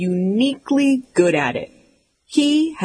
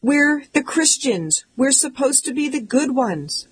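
much higher, a light mock plea; pleading mom; 7 semitones higher than usual. Mom begging her sons to stop, high mock pleading. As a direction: plead with the boys like a worried mom. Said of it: "my mom pleads".

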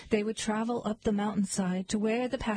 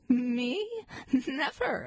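mildly indignant, higher on "Never"; lightly acted proud protest; proud exclamation; 4 semitones higher, slower than usual. A narrator's reading. Goat refuses the troll, proudly exclaiming. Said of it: "exclaimed the goat proudly".

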